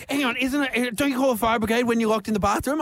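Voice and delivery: high, quick earnest question; innocently puzzled, rushing; naive question. Retold or imitated. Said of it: "she goes".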